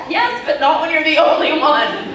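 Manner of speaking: loud, high mock protest; retold objection, punched out loudly; exasperated comeback. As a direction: argue back, voice jumping up.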